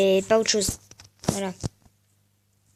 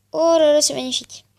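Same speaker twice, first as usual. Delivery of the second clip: delighted; 5 semitones higher, 10 dB louder than usual. Real, not acted. Delighted at colour change.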